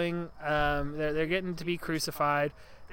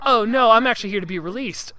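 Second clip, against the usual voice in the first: higher, loud eager protest; mock prisoner; 7 semitones higher, 10 dB louder than usual. Prisoner correcting the Roman guard, loud eager protest. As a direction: plead it brightly. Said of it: "he's like".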